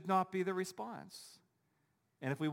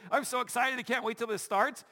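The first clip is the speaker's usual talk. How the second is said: much higher and louder, bubbly with excitement; excited gushing; 8 semitones higher, 5 dB louder than usual. Retold or imitated. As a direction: gush like a fan who can't wait. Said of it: "everybody's all excited".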